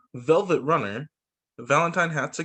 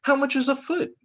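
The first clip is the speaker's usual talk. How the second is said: put-on little girl question; 10 semitones higher than usual. Child Geraldine asking.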